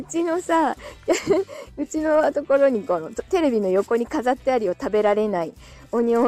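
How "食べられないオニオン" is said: ordinary talk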